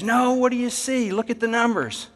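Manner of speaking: pressing the point. Retold or imitated. Foreman pushing crew to look.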